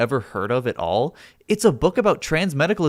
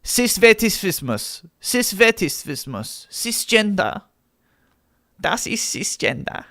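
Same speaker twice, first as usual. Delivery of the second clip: slow, exaggerated German accent; higher, drawn-out German accent; mock German accent; 5 semitones higher, slower than usual. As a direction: pronounce it like a stern German lecturer. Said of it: "You said the British accent was racist".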